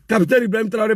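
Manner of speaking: normal talking voice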